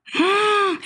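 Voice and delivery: sharp high gasp of shock. Retold or imitated. Reliving the online insults, a sharp shocked gasp. Reacting to cruel comments.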